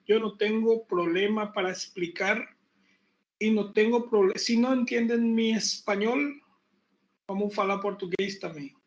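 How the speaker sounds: ordinary talk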